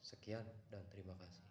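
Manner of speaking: ordinary talk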